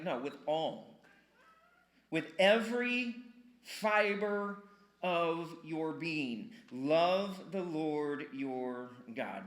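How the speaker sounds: ALL and EVERY leaned on, slow and weighty; emphatic, slow. Retold or imitated. Quoting God's answer to half measures, slow and emphatic. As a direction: drag out "With every fiber of your being". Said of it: "he's like".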